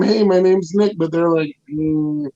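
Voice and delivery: ordinary delivery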